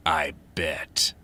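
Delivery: low creepy laugh then whisper; creepy chuckle. Acted. Creepy delivery guy laughs.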